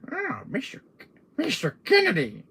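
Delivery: high-pitched, teasing little villain; squeaky mock-villain greeting; annoying little voice. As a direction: pipe up in a silly high voice. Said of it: "Salazar's little annoying little voice".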